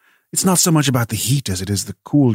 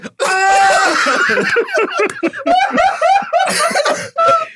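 dolphin clicks; 4 dB louder than usual. Acted. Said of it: "I'll do my dolphin".

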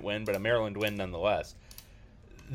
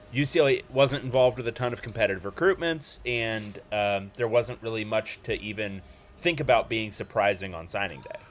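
usual speaking voice

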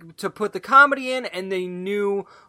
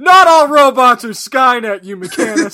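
mock outrage; 4 semitones higher, 9 dB louder than usual. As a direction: defend the robots in fake outrage. Mock defence of robots.

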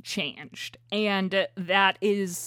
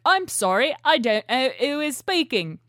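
mock British assistant, higher and louder; British Siri voice; 4 semitones higher, 4 dB louder than usual. Wife quotes the HomePod in a British Siri accent. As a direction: put on a prim British robot accent. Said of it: "That was my British Siri voice".

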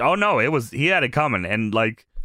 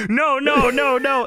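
high repeated no's; mock frantic denial; 10 semitones higher, slower than usual. Host acting out Mister America denying, high. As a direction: rattle off the no's.

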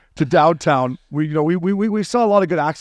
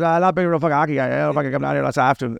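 quick imitation of a Newfoundland accent; faster than usual. Cab driver brushing off hockey, fast Newfoundland accent. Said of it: "you got a good accent there".

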